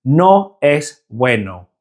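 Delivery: "No es bueno" slow, with short pure EH and O; Spanish E and O. Shown as a demonstration. Teacher giving a practice phrase, slow Spanish "No es bueno". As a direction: say "No es bueno" slowly as naw ess BWEH-no. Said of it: "practice the E and the O sounds".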